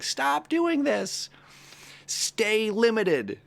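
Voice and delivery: high, slow, eerie voice of the fearful brain; drawn-out high warning from the inner voice; the brain telling you to stay small, high and slow; the brain's voice. Retold or imitated. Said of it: "the brain saying".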